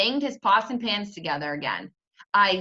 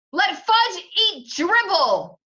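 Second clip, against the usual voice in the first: slow, loud, much higher outcry; screaming; 10 semitones higher, 6 dB louder, much slower than usual. Acted. Peter screaming at his mother in disbelief, loud. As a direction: scream each word out. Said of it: "I screamed".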